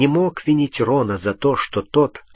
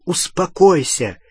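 raised, higher-pitched command; urgent shout; 6 semitones higher, 3 dB louder than usual. A narrator's reading. Harry ordering Hermione to calm down, shouting. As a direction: cry out to calm her down. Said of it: "крикнул Гарри".

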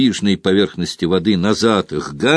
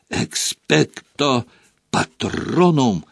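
slow, drawn-out incantation, higher pitched; cried-out spell; 5 semitones higher, much slower than usual. A narrator's reading. Harry casting the Patronus at the dementors, crying out slowly. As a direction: call out the spell slowly, without real volume. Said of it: "крикнул он".

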